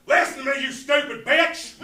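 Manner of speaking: high, loud outburst with the last words dragged out; acted angry yell. Man in waiting room losing it at Siri, angry shouting. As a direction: blow up at the phone in full voice.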